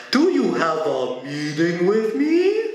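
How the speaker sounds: mock formal question, stretched out pompously; put-on official voice. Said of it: "So he drops the voice at this point".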